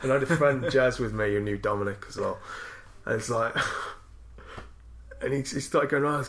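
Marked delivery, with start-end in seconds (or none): none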